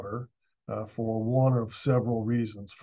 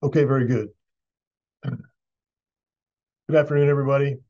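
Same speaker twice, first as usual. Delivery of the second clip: in front of an audience; 3 semitones higher, 7 dB louder than usual. Real, not acted. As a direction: project to the whole room. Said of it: "Can, can everybody hear me okay?".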